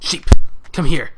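loud call to an animal. Real, not acted. Player calling a sheep over, loud call. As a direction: shout it across the field.

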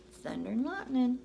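usual speaking voice

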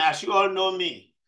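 ordinary talk

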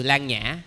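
"Lan Nhã" with no leading A; initial A dropped. Shown as a demonstration. Monk shows the shortened term, first syllable dropped. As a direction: say only "Lan Nhã", leaving off the first vowel. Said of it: "chữ A đó người ta lược đi luôn".

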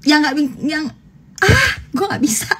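high, hammed-up whine of defeat; falter, then give up in a high wail; mock giving up. Streamer acting a lazy person's failed pep talk, mock whiny.

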